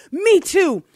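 high, loud, slow, breaking into laughter; fighting the giggles mid-list; laughing through the line. A narrator's reading. Voicing second man, laughing.

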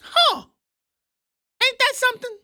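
high, mock-astonished huh; sarcastic high-pitched huh, ain't that something; sarcastic amazement.

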